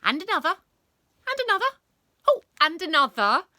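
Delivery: high, loud, eager pupil; excited pointing out. A narrator's reading. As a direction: call out each new sighting urgently. Pupil gleefully points out imaginary balls.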